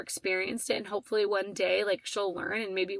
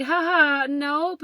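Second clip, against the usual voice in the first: retold awkward ha ha, higher and louder; awkward laugh; 6 semitones higher, 5 dB louder, much slower than usual. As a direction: laugh it off nervously, then nope. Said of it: "like, an awkward ha ha".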